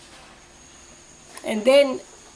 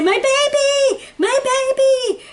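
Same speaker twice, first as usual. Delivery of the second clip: high, slow anguished wail; 4 dB louder than usual. Retold or imitated. Mother learning her baby died, wailing high. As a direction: cry out for the lost baby. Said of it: "I said".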